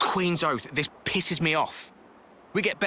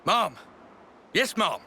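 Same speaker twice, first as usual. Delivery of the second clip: crisp military acknowledgement; 4 semitones higher, 3 dB louder, slower than usual. Acted. Soldier answering the commander's order.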